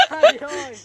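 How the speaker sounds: sharp high cry of pain. Real, not acted. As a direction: yelp out in real pain. Pain after getting scratched.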